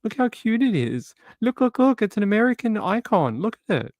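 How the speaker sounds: high, squealing mock delight over a baby eagle; mock giddy, high-pitched cooing; gushing tourist. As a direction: coo like a thrilled tourist showing off a pet. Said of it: "walking around with it going".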